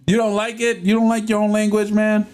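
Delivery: high, fast amused disbelief; incredulous and laughing; surprised disbelief. Real, not acted. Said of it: "reacting to viewer's comment".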